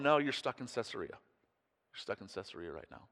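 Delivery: usual speaking voice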